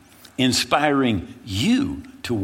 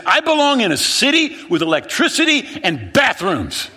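panicked demand; 12 semitones higher, 6 dB louder than usual. Retold or imitated. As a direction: demand an answer, losing it. Shaking friend, demanding to leave.

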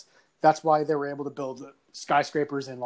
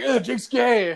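raised-pitch mock schoolkid taunt; imitated classmates' jeer; 6 semitones higher, slower than usual. Classmates teasing him in front of everyone, mock jeering.

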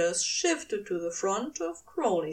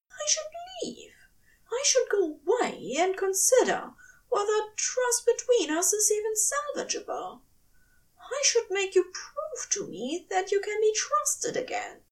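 higher, taut angel voice, whispered start; hurt stern angel; 10 semitones higher than usual. A narrator's reading. Angel telling his demon he must leave, hurt and firm. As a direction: hold back hurt with firm resolve.

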